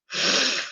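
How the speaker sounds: quiet tired exhale; quiet sigh of a tired reader; soft weary sigh. Real, not acted. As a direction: sigh out softly, worn out. Tired after long reading session.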